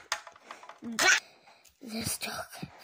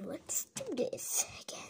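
ordinary talk, nothing marked

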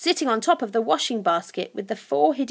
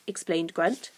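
ordinary delivery, nothing marked

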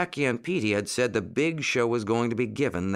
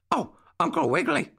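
put-on high, quick puppy-boy voice; excited puppy bark; faster than usual. A narrator's reading. Jackie runs up to Uncle Wiggily with news, excited high puppy bark. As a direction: yip it out in a high voice. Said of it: "barked Jackie".

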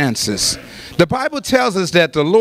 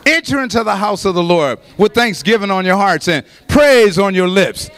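ordinary delivery

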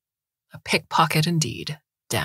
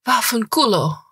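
angry curse; 6 semitones higher than usual. Acted. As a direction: spit the curse out. Ippolita curses at Dante.

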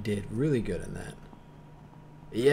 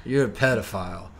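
loud, emphatic retort; mock public rebuke; 10 dB louder than usual. Everyone answering Spacey's coming out, emphatic mock rebuke. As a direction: quote an exasperated crowd setting him straight.

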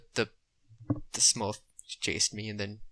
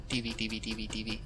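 muttering; 2 semitones lower, 7 dB quieter than usual. Real, not acted. Mutters to self.

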